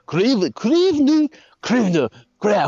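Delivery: practicing a character voice. Shown as a demonstration. Walking the track, trying out a new character voice on Krivnu. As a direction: try the name Krivnu in a high, stretched-out character voice. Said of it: "trying to practice new ones".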